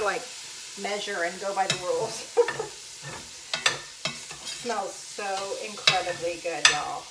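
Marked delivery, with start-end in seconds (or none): 2.2-4.6 giggle. Real, not acted. Laughing at her own messiness.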